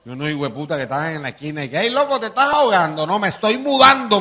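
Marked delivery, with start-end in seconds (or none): 1.8-4.2 high, loud mocking call from the corner; loud high-pitched retold banter; mock shouted exchange. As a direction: yell it up high like street guys teasing. Hecklers and deadpan reply joke.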